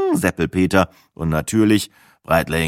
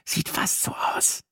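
hushed; 8 dB quieter than usual. A narrator's reading. Sina replies wryly, hushed.

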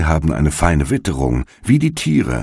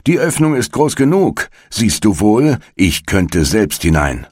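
scornful witch; 6 semitones higher than usual. A narrator's reading. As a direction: scoff and show her how easy it is. Witch shows the opening.

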